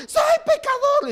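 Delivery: very high, loud mock wail; hammed-up sobbing cry; mock crying sinner. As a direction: parody a weeping penitent. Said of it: "la gente lloraba".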